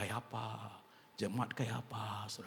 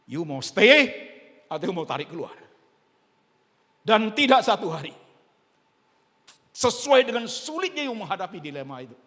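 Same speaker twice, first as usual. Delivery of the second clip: preaching at full force; 11 semitones higher, 15 dB louder than usual. Acted.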